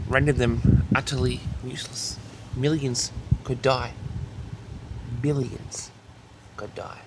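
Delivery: ordinary talk, nothing marked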